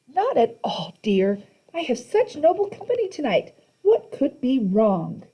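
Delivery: put-on sweet old lady. A narrator's reading. Monster posing as granny reassures the kids, honeyed. Auntie Em voice.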